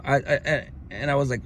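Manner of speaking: ordinary talk, nothing marked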